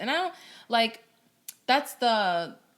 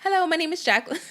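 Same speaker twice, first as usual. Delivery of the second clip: higher, louder, overdone professional greeting; extra customer service voice; 9 semitones higher, 6 dB louder, faster than usual. Shown as a demonstration. Guest to host, overdoing a service greeting. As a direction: ham up the polite phone greeting. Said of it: "I sound so extra".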